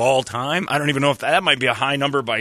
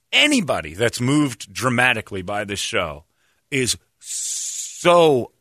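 usual speaking voice

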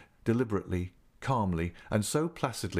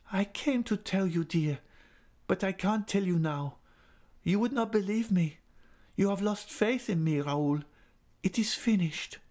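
despairing; 11 semitones higher than usual. A narrator's reading. Christine telling Raoul it is over, despairing. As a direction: give up hope as you speak. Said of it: "in such a despairing voice".

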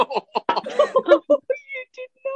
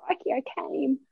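higher, softer Australian exclamation; playful Australian catchphrase; 6 semitones higher, 6 dB quieter than usual. Acted. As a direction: play up the Australian accent lightly. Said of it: "the fact that you're Australian".

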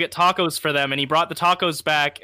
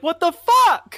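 shocked disbelief; 13 semitones higher, 5 dB louder, slower than usual. Retold or imitated. Canyon learns he missed them.

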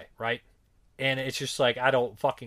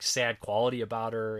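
normal talking voice

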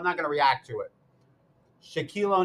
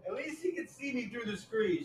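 ordinary talk, nothing marked

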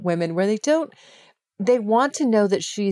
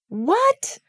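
high, rising shocked "What?"; imitating her friend's shocked reaction; 12 semitones higher than usual. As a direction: squeak out the disbelief. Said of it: "the way she delivered that line".

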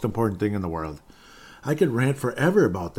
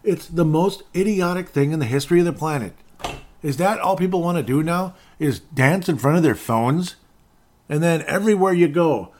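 high, loud, outraged rant; fed-up ranting, voice up high; exasperated rant; 7 semitones higher, 6 dB louder than usual. Real, not acted. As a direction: vent the outrage at full volume.

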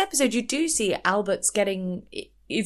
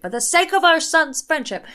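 higher, louder, fussing; mock flustered plea; 9 semitones higher, 6 dB louder than usual. Mimicking a flustered Caroline begging for peace, higher. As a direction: plead it in a fluster. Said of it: "Caroline gets quite flustered".

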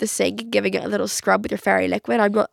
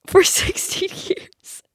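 high, drawn-out words breaking into laughter; laughing; 12 semitones higher, much slower than usual. Real, not acted. Telling listeners about the old sick bowl, laughing. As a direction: laugh helplessly through the words.

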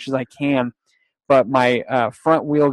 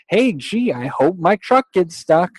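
sarcastic mock chirp; 5 semitones higher than usual. Sarcastic mock thought to boss.

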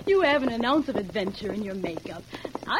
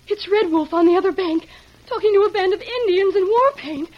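alarmed; 6 semitones higher, 7 dB louder than usual. Acted. Woman sees the war party.

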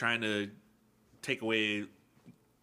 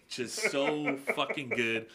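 ordinary talk, nothing marked

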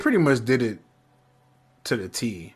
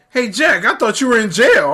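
put-on cheeky little kid, higher pitch; high-voiced imitation of a needling little boy; Armand; 8 semitones higher, 4 dB louder than usual. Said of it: "now we get Armand the eighteenth".

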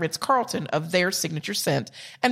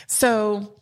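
ordinary delivery

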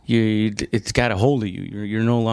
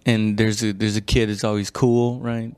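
normal talking voice

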